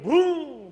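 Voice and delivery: loud, high drawn-out boom; sudden swooping explosion noise; imitated mortar boom. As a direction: mimic a mortar blast.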